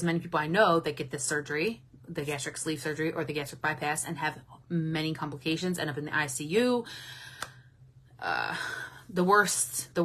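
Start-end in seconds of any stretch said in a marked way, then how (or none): none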